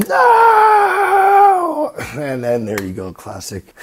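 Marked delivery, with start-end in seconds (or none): 0.1-1.9 high, loud mock wail of no; Luke's scream. Luke screaming at the reveal, loud high wail. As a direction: belt out a dramatic movie scream.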